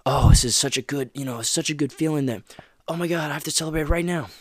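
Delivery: mock someone over-celebrating a strikeout; mock overexcited celebrating. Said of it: "you treat it like".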